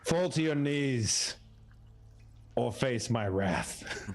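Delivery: slow, drawn-out mock decree. Paladin commanding kobolds to kneel, slow mock decree. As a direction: hold every word like a pompous paladin. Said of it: "I basically say".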